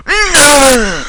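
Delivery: high, loud yelp of No!; high, loud shouted no; sudden shout. Pretend. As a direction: blurt it out as an annoying noise. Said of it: "one of my annoying sounds".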